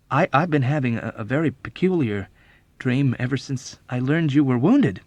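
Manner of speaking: confessing. A narrator's reading. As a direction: confess it haltingly. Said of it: "confessed the chaplain".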